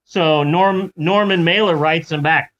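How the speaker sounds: ordinary talk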